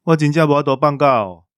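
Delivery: ordinary talk, nothing marked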